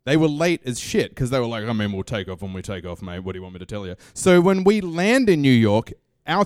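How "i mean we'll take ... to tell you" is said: low, soft, couldn't-care-less reply; laid-back airline staff. Retold or imitated. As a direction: shrug it off under your breath as Aussie crew. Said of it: "because it's an Australian airline".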